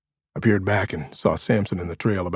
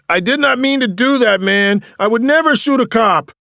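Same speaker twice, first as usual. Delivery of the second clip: frantic high yell, much louder; 9 dB louder than usual. A narrator's reading. Restrained Diggs yelling his innocence, frantic shout. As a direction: yell it face down into the leaves.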